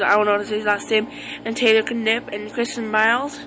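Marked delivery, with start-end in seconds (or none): none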